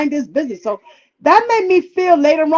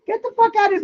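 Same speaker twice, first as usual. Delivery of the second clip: much higher, mock-outraged brush-off; dismissive retort; 8 semitones higher, 3 dB quieter, much faster than usual. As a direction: re-enact sending him away, without shouting. Acted retort before a shove.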